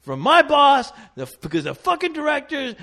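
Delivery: high, loud, hammed-up ranting; mock angry rant. Assistant director venting at the extras, hammed-up angry rant. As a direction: rant like a chewed-out boss. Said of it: "he basically just said".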